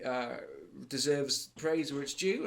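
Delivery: normal talking voice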